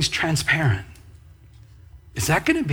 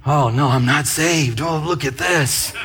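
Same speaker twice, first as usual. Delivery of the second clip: hammed-up despair, rising to a near shout at the verse; mock panic. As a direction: act out a believer sure they are damned. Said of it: "it can even beat you up".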